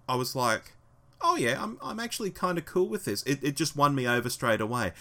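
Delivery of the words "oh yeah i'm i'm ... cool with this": high-pitched, retold change of heart; pleasantly surprised. Fan recalling being won over by the episode, pleasantly surprised. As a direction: lift the voice as if coming round to it.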